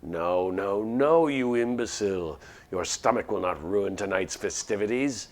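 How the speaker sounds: loud, slow, growling creature scolding; furious scolding. Acted. Monster berating its hungry companion, furious roar. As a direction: bellow the insult at a fool.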